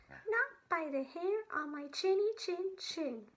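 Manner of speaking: little pig refusing the wolf. Acted. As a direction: squeak it out like a small defiant pig.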